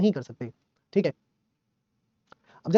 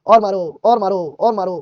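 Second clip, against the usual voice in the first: put-on cheeky defiant kid; 7 dB louder, slower than usual. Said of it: "तुम धाड़े मार रहे हो हंस हंस के".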